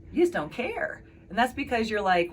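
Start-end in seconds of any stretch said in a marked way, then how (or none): 0.1-1.0 accusing. Retold or imitated.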